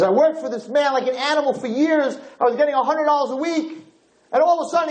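high-pitched, indignant grievance list; angry complaint. Retold or imitated. Wronged worker complaining to the king about his boss, high and indignant. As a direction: air each grievance in a high, outraged voice. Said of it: "he is so angry at his boss".

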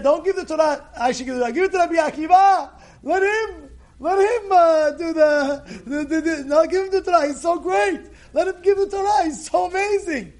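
high, loud, insistent plea; urgent pleading. Acted. Moshe urging Hashem to choose Rabbi Akiva, urgent pleading. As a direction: build into awed insistence.

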